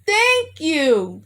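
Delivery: loud, high, overjoyed shouts of thanks; hollered thanks. A narrator's reading. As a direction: holler it so the whole plantation hears. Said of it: "he hollered loud enough for everyone".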